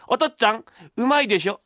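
high, showing-off son voice; imitating the young master. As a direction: call out proudly in the mimicked voice.

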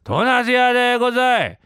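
high, earnest street call; naive, held-out hawker's holler; vendor's cry attempt. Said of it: "ちっとも売れねえじゃねえか".

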